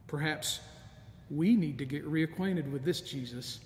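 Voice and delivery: ordinary delivery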